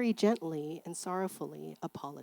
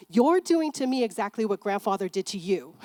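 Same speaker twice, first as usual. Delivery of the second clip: louder, firm confrontation; said to her father; 7 dB louder than usual. Retold or imitated. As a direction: stand up to him firmly, louder.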